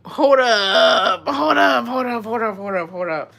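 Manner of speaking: high, loud and strained, then fading; stopping everything in disbelief; shocked disbelief. Real, not acted. Shocked by the secret.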